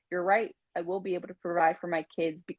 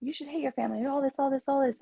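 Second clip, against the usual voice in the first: higher-pitched mimicry of meddling advice; raised voice for quoted outsiders; imitating other people; 8 semitones higher, faster than usual. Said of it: "they always say, like".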